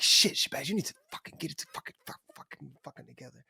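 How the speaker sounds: hissed whisper scolding yourself, fading to almost nothing; a whispered inner critic, rushed then fading out; harsh self-talk whisper. Retold or imitated. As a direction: berate yourself under your breath, dying away. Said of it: "we're like".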